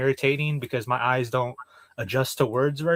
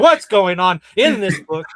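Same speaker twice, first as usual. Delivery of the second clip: exasperated outburst; 4 semitones higher, 8 dB louder than usual. Retold or imitated. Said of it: "And I was like".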